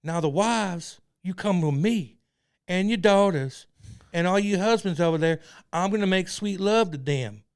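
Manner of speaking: loud, slow preacher drawl; cult leader impression. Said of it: "impersonation of him?".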